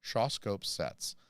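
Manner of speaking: "Shawscope sets" spelled out slowly, each syllable placed; slow deliberate "Shawscope sets" after tripping over it; slow, careful. Shown as a demonstration. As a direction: carefully sound out "Shawscope sets" so you don't trip on it. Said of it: "that feels like a very difficult thing to say three times fast".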